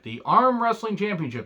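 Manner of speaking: high, scoffing repeat of the title; mock incredulous. Shown as a demonstration. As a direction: sell how absurd the title is. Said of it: "they had championships for everything".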